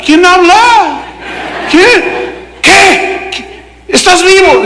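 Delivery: very high-pitched, hammed-up astonishment; startled calling. King finding Daniel alive among the lions, startled high calling. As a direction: squawk it out in mock shock.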